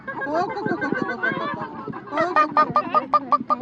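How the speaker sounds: high, loud clucking hen. Pretend. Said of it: "Как курочка говорит".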